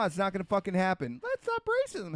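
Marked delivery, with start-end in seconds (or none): 1.2-2.2 very high-pitched mock voice; mocking naive do-gooder. Mimicking people who only talk about racism, mocking. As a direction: put on a squeaky, clueless idealist.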